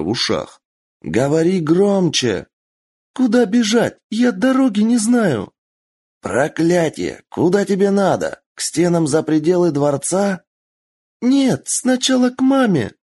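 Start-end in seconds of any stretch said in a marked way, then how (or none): 1.0-2.5 shouting over wind. Acted. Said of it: "проорал сквозь свист ветра". 3.1-5.5 put-on high girl shouting over wind; little girl voice. Kitsune girl panics. 6.2-10.4 shouting over wind. Acted. 11.2-12.9 put-on high girl shouting over wind; little girl voice. Kitsune girl.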